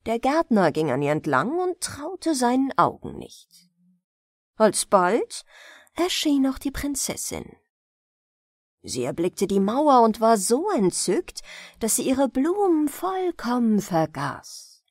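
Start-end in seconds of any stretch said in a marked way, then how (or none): none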